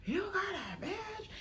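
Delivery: half-sob the accusation; hurt accusation. Retold or imitated. Girlfriend accusing boyfriend of another woman. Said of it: "I'm like".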